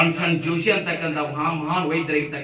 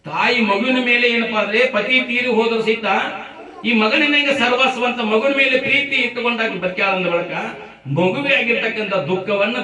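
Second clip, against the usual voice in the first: raised, emphatic orator's voice; impassioned preaching; 7 semitones higher, 6 dB louder than usual. A narrator's reading. Preacher tells listeners of a widow living for her son, impassioned. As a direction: preach it high and loud. Preacher builds the mother's plight.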